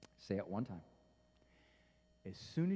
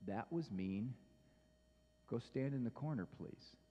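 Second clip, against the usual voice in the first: softer, low and calm request; 5 semitones lower, 9 dB quieter than usual. Shown as a demonstration. Parent calmly sends a child to the corner. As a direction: tell the child gently, no edge at all. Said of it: "in a calm tone of voice".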